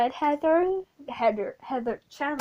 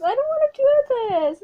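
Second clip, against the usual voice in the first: much higher, louder whiny protest; reluctant Veronica; 13 semitones higher, 7 dB louder than usual. Retold or imitated. Veronica refusing the Heathers, high whiny protest. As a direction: whine it like a reluctant teen. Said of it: "And Veronica goes".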